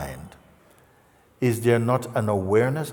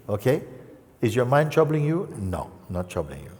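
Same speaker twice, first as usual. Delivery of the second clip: ordinary delivery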